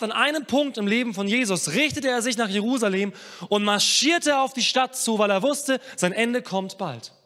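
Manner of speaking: loud, high pulpit storytelling; impassioned preaching. Acted. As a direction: tell it at full force from the pulpit. Preaches at full force.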